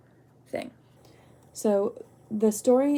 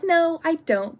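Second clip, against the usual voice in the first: higher, louder acted denial; retold protest; 8 semitones higher, 6 dB louder, slower than usual. Speaker answering the ex's guilt trip, mock protesting. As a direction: protest it as a quick retold objection.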